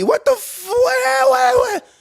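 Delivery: very high, loud indignant shouting; can't believe the clock ran; outraged disbelief. Real, not acted.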